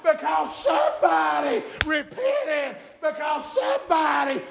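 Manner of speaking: loud, high-pitched revival sermon delivery; full-force preaching. Acted. Preacher urging the church to praise the Lord, full-force preaching. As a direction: preach it hard, stretching key words out.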